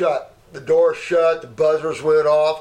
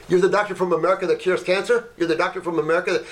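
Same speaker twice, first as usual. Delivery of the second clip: higher, rapid-fire questioning; excited retold guard; 5 semitones higher, faster than usual.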